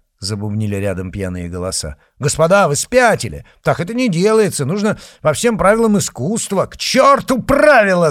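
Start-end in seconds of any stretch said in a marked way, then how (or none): 2.2-6.7 loud, high alarmed outcry; alarmed protest. Acted. An officer shouting at comrades about to duel, alarmed. 6.7-8.1 roared, very high and loud; roaring. Acted. Izotov roaring at the officers who insist on rules, furious. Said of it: "заревел Изотов".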